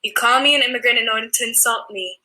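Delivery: ordinary talk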